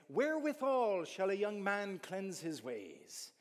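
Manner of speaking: put-on an Irish accent for an old King James verse. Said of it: "not in that accent".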